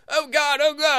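high, breathless-style calling on God; mock cries of passion. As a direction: cry out as if mid-sex. Overheard cries during sex.

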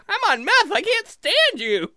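high, squeaky elf retort; high-pitched cartoon outburst; drunk elf. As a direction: answer back in a tiny high voice. Elf answers the man's question.

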